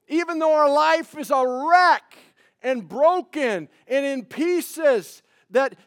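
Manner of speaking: loud, high, slowed preaching climax; full-force preaching. Acted. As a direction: proclaim it to the whole church.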